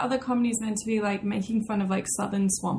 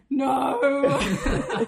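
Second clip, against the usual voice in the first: long-drawn "no", half laughing; retold dismay; 2 semitones higher, 5 dB louder than usual. Telling co-hosts her reaction to the voice, mock dismay. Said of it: "I was like".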